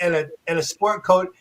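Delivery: ordinary talk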